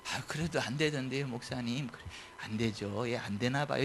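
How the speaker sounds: low, soft, defeated murmur; dejected, subdued agreement; mock resignation. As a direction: give up meekly. Acting doubter and defeated pastor.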